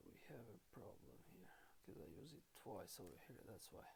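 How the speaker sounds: soft, low self-correction under the breath; muttered to self. Real, not acted. Teacher fixing a typo, muttering quietly to herself. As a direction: mumble the fix to yourself, barely voiced.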